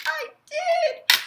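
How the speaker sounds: very high, loud gloat; proud squeal. Retold or imitated. Answering her friend, high and smug.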